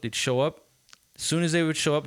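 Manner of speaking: ordinary talk, nothing marked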